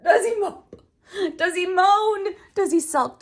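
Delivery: hammed-up pouty read; whiny questions. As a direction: act out a sulky dinosaur. Acting out whiny dinosaur.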